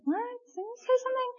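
squeaky high disbelieving question; high-pitched comic double take; mock baffled dad. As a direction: play the stunned father who can't believe his ears. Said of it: "looking at Kirk going".

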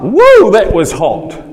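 loud, slow, exhilarated whoop; excited whoop. Real, not acted. Preacher reacting to the fire he feels, excited whoop. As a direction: let it burst out like heat hit you.

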